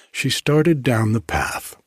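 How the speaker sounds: ordinary delivery, nothing marked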